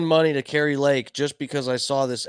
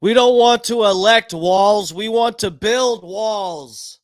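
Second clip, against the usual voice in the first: high, loud, hammed-up Trump voice, the last line slowed; Trump impersonation; 8 semitones higher, 4 dB louder, slower than usual. Mocking the speech with a stolen Trump impersonator's joke. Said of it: "I stole that from a, a Trump impersonator".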